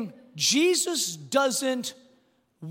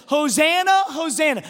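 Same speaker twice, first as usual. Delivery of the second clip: loud, high joyful hosanna; crowd cheering; 4 semitones higher, 8 dB louder than usual. Pretend. Crowd cheering Jesus in, loud hosanna.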